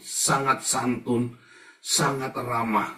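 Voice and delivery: drawn-out sangat santun, sangat ramah; slow stress on sangat. Acted. Preacher warning the congregation, slow stressed sangat. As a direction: lean slowly on each sangat. Stressing over-politeness slowly.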